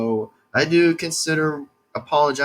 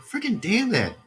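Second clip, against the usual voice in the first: mock exasperation; 6 semitones higher than usual. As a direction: replay the annoyance in a higher pitch. Retold frustration at internet loss.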